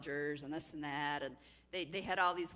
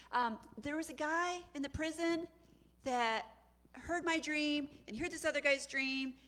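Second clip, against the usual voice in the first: lightly voiced servant, pitched up; hint of a careful servant reporting, high; sheepish cupbearer; 7 semitones higher than usual.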